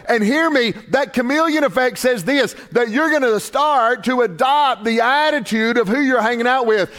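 high, ringing, driving preacher's cry; full-force preaching. Acted. Pastor urging the church not to drift toward the devil, preaching hard. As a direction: build it to a climax. Climax of sermon point.

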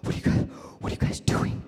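breathless, whispered, then rising high eager plea; whispered wannabe, climbing to a high plea; creepy dude. Retold or imitated. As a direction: play the creeper begging to join. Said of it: "he's like".

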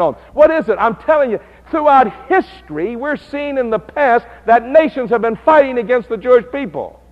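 high, loud, fervent preaching; the preacher lets it rip, loud and high; preaching at full force. Acted. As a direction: thunder it from the pulpit.

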